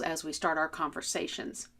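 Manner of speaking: usual speaking voice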